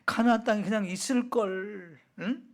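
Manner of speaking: regretful lament. Acted. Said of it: "땅을 치면서 후회를 했을까요".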